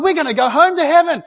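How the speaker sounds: loud, high, ecstatic cries of praise; joyful praise shout. Retold or imitated. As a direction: shout praise to God over the storm. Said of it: "They're going".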